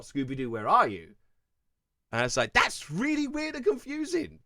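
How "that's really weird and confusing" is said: high-pitched retold bafflement; baffled reaction. Vlogger recalling a confusing show history, baffled and high. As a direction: act out puzzlement, pitched well up.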